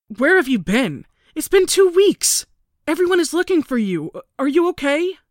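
high, loud frantic worry; frantic mother on the phone. A narrator's reading. Mother frantic on phone to missing daughter. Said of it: "She was frantic".

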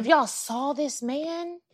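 If y'all saw this man drawn out slow and soft; a slowed swoony echo; slow dreamy echo. Real, not acted. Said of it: "echoed line".

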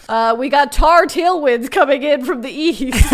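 lightly hammed-up TV meteorologist; playful weather-report delivery. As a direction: announce it loud and lifted, like a mock forecast. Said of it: "doing the weather report".

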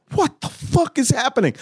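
high-pitched, incredulous outcry; high, alarmed bewilderment; stunned disbelief. Retold or imitated. Friend's stunned reaction, acted out.

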